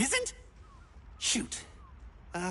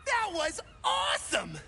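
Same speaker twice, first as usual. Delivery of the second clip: amazed shout; 5 semitones higher, slower than usual. Acted. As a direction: cheer like you just saw magic. NPC amazed by player's prayer.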